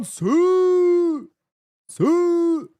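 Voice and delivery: high, long-held whoop; celebration cheer. Acted. Streamer cheers along with a viewer, drawn-out high shout. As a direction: shout it out and hold the vowel.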